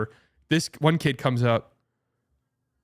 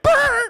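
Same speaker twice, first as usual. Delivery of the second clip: high, loud buzzing lip blare like a horn; loud trumpet blare; 7 dB louder than usual. Retold or imitated. Kids blaring trumpets during breathing exercises, loud buzz. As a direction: blast a raspberry like a blaring trumpet. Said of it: "blare out a fucking loud ass horn".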